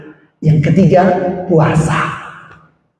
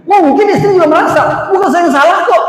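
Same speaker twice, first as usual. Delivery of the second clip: mock wife's stubborn voice; 11 semitones higher, faster than usual. Voicing the wife's side mockingly.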